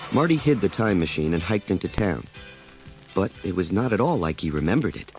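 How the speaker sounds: ordinary delivery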